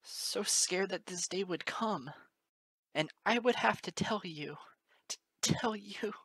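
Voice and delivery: fear catching in the throat; scared trembling. Acted. Moon Dancer dreading telling Lyra, trembling.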